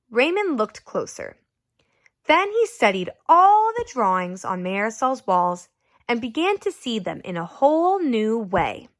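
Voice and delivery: ordinary delivery, nothing marked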